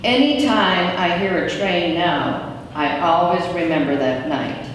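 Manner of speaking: ordinary delivery, nothing marked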